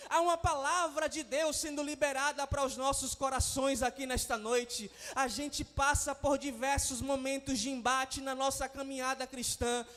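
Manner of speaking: loud, impassioned preaching over music; preaching at full force. Acted. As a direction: build the fire of a revival sermon, stretching key words. Pastor preaching to congregation. (pregação inflamada e forte)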